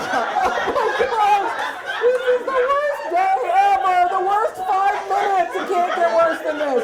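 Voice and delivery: high, loud, drawn-out comic despair; mortified wail. Acted. Mortified patient on the worst day ever, in a loud mock wail. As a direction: wail it as a hammed-up lament. Acted mortified reaction to the doctor.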